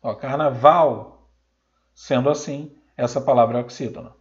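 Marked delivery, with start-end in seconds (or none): 0.1-1.3 carnaval slow and loud, leaning on VAL; stress on last syllable. Shown as a demonstration. Teacher confirming the last syllable is stressed, carnaval with long loud VAL. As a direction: stretch the final VAL of carnaval. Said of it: "que é a sílaba tônica".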